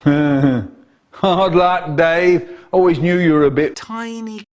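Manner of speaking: taunting while laughing; cheeky mocking cackle; laughing gloat.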